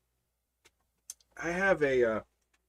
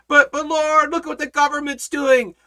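higher, pleading mock complaint; whiny worried believer; 10 semitones higher, 5 dB louder than usual. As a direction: play the anxious believer pointing at enemies. Mimicking worried believer again.

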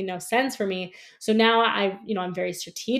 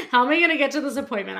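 higher, louder, fast retold panic with a laugh; stressed out; 5 semitones higher, 5 dB louder, much faster than usual. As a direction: laughingly replay a rushed moment of stress. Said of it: "I was, like, so stressed".

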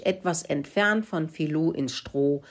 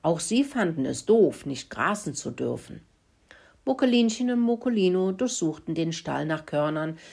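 ordinary delivery, nothing marked

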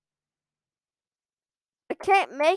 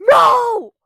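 sudden high, loud yell of defeat; frustrated shout; 10 semitones higher, 13 dB louder than usual. Real, not acted. Gamer yelling at missed jump, loud frustrated shout. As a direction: scream no as the jump fails.

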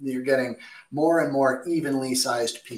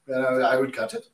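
ordinary delivery

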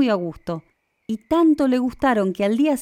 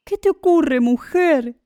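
higher, startled question; worried, raised voice; alarmed husband; 6 semitones higher, 3 dB louder, slower than usual. A narrator's reading. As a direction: ask with anxious concern. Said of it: "le preguntó asustado su marido".

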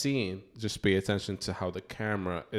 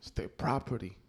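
[name] voice; 4 semitones higher, 3 dB quieter than usual. Retold or imitated. Continues [name] impression.

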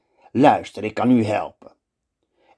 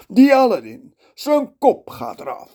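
put-on much higher, old sultan's grumble; 13 semitones higher, 3 dB louder than usual. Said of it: "mompelt hij".

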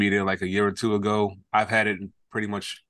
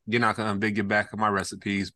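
ordinary delivery

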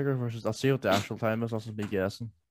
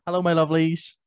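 TikTok voice; 6 semitones higher than usual. Retold or imitated. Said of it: "that TikTok it does those".

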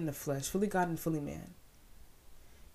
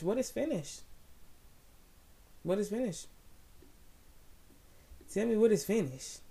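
higher, calling out to the crowd; call and response; 5 semitones higher than usual. Real, not acted. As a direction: demand an answer.

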